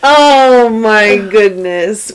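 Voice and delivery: slow charmed reaction; drawn-out amazement. Real, not acted. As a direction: savor the happy ending. Listener reacts to the story.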